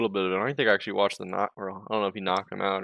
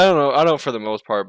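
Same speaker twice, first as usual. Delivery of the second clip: usual speaking voice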